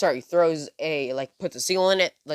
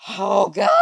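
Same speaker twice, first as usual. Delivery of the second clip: scared; 4 semitones higher, 6 dB louder than usual. Retold or imitated. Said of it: "he's shitting himself".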